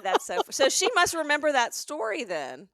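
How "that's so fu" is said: normal talking voice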